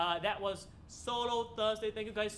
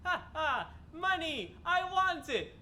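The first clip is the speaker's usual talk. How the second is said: mock greedy cry; 8 semitones higher, 5 dB louder, slower than usual. Mock greed after SMRT joke.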